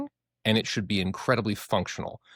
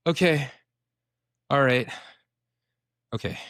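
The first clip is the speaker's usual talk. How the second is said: settling down with a sigh; 4 semitones higher than usual. Retold or imitated. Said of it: "your amygdala goes".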